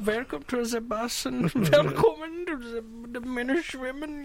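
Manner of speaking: hammed-up German accent, high and soft, trailing away; little German lady. Joking welcome onto the bus, little German lady voice. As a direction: play a tiny German lady, half laughing. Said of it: "Why do you sound like a little German lady".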